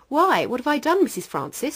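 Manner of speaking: high, loud burst of mock surprise; feigned innocence. Accused man answering the mother, high mock surprise. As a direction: play innocent, startled.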